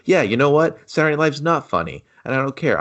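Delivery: usual speaking voice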